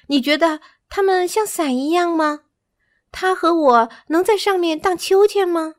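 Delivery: put-on high, childlike questioning voice; puzzled little monkey. A narrator's reading. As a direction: ask question after question, wondering aloud. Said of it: "扎西若疑惑道".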